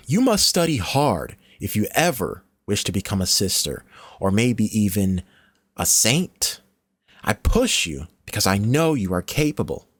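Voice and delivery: stern nun, firm but caring; tough-love mentor. Acted. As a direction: lecture the child with tough love. Stern nun lecturing the girl.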